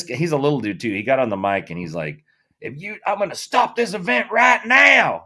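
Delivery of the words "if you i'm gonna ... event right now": mock country announcer threatening the crowd; country official yelling. Small guy on the mic threatening to stop the event, high loud Southern voice. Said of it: "He's got a heavy country accent too".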